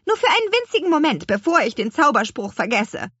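high, loud, hurried plea; impatient annoyance. A narrator's reading. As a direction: beg crossly for one moment. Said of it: "stampfte unwillig auf". (unwillig und gehetzt)

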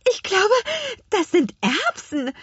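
high, breathless wonder; puffed, amazed high voice; puffing in surprise. A narrator's reading. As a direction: pant it out, astonished. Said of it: "schnaufte Lea verwundert".

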